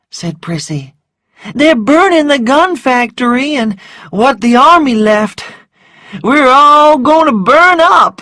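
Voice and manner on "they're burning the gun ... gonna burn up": put-on high, loud, frantic servant girl; excitable high-pitched wail of alarm; panicked servant girl. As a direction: cry out the alarming news in a high voice. Panicked servant Prissy reports the fire.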